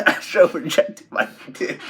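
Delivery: loud laugh swallowing the words; cracking up. Real, not acted. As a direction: barely get the punchline out through laughter. Cracks up at own joke.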